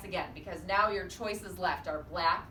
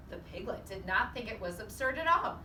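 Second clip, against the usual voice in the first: ordinary delivery, nothing marked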